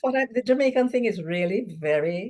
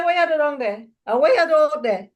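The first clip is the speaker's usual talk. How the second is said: imitating Kingsley's Jamaican lilt, falling at the end; Jamaican intonation; 8 semitones higher, 4 dB louder than usual. Imitating Kingsley for the panel, Jamaican lilt. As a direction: quote it like Kingsley, in lilting Jamaican. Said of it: "King say".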